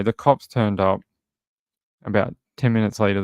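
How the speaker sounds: ordinary talk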